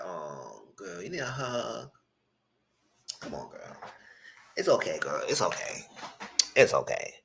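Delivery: soft, low, soothing there-there; gently consoling the confused contestant in mock pity; mock comforting. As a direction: hush her like a crying friend.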